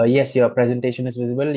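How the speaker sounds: ordinary talk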